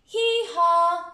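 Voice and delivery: loud, high drawn-out hee-haw; donkey bray. Acted. Donkey braying in a story.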